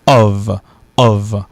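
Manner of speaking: "ov" avec un V final; la préposition "ov" dite avec V. Shown as a demonstration. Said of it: "la préposition, ça se prononce". ("of" prononcé "ov")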